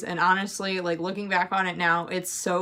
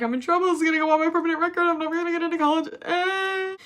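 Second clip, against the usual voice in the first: high, rushed mock wail; hammed-up frightened student; scared panic; 9 semitones higher, faster than usual. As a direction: panic fast and high, ending in a whine. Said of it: "I was scared".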